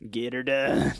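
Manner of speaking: lightly voiced comic catchphrase; Larry the Cable Guy. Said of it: "doesn't even sound like that".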